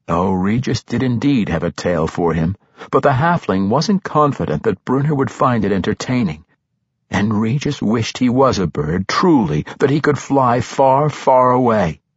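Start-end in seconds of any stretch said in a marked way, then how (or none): none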